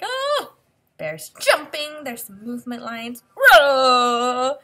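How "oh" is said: high, loud startled "Oh!"; playful mock shock; surprised gasp. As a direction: voice the frog's sudden surprise. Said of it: "Frog is like".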